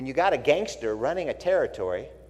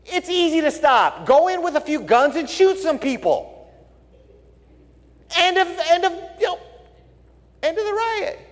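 ranting; 13 semitones higher, 4 dB louder than usual. Real, not acted. Pastor rants about riots.

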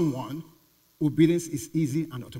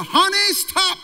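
loud, high shout of warning; yelling; 13 semitones higher, 8 dB louder, slower than usual. Pretend. Father yelling to his daughter to stop, loud shout. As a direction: yell to stop a child from danger. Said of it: "And you yell to her".

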